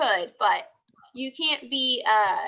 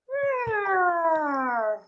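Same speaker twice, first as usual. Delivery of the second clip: grumpy monster growl held long; monster grumble. Acted. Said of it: "Frankenstein would say".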